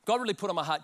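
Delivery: ordinary talk, nothing marked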